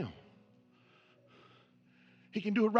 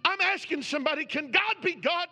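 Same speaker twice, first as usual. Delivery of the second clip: higher, louder, driving preacher cadence; full-force preaching; 7 semitones higher, 4 dB louder than usual. Acted. Preacher pressing the congregation to believe now, at full force. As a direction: preach it hard and lift every question.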